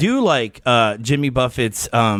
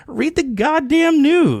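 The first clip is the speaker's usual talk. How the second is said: high, slow exasperated outburst; exasperated yell; 13 semitones higher, much slower than usual. Retold or imitated. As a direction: drag it out like you're fed up. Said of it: "I'm just like".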